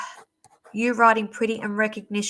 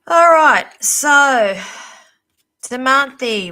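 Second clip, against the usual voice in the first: loud call; 4 semitones higher, 10 dB louder, slower than usual. Real, not acted. Calls next reader to attention.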